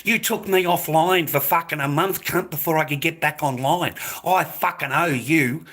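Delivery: normal talking voice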